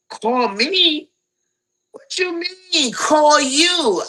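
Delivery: high, loud mock outrage; indignant disbelief. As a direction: fire back in shocked disbelief. Mock outraged retort to mother.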